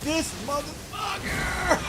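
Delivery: high, slow, stretched outraged yell; outraged shout. Real, not acted. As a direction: scream at the enemy in disbelief. Gamer outburst at enemy.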